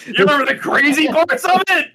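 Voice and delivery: you can't be serious, loud; incredulous shout. Real, not acted. Friend yelling in disbelief at the dream teller.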